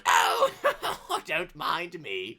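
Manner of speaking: lightly voiced granny with a chuckle; coy granny. Granny to cheering soldiers, coy and chuckling. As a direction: chuckle it out, drawing the words slowly.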